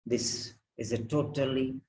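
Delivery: normal talking voice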